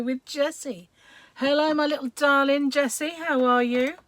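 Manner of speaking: normal talking voice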